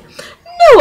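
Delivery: very high, loud contradiction; mock emphatic denial. Teacher disputing the student's reading of instructions, mock emphatic denial. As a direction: imitate a teacher's outraged no.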